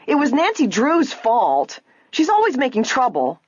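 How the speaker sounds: high-pitched, indignant accusation; haughty shrill. A narrator's reading. Ada blames Nancy for the torn dress, haughty shrill. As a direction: shift the blame in a shrill complaint. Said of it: "her haughty sister shrilled".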